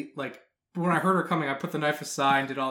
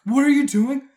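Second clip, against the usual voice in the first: high, loud alarmed questioning; shocked girlfriend; 10 semitones higher, 10 dB louder than usual. Retold or imitated. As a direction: mimic her horrified double take. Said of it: "Sydney comes in".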